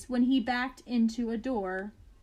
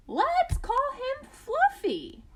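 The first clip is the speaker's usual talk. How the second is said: high, slow and sweet, like a doting mother; sweet parent voice; 13 semitones higher, slower than usual. Acted. Doting mother cooing the baby's name, high and slow. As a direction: coo the name to the new baby. Parents naming their baby.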